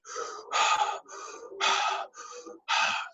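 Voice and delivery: long heavy breath out showing the breathwork; demonstrating fast circular breathing with a big exhale; demo breath. Shown as a demonstration. The speaker shows the podcast host the breathwork, a heavy breath out. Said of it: "So it was like".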